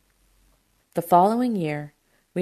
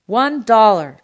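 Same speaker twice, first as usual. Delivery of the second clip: loud, raised shout in answer; shouting back; 4 semitones higher, 10 dB louder, slower than usual. A narrator's reading. Produce man answers uncle on the phone, shouting back. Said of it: "D'Agostino shouted back".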